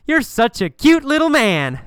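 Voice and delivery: high, loud mocking taunt; mocking laughter. Tall man challenging little Jim, high mocking taunt. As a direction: jeer it in a high, teasing voice. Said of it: "laugh and say".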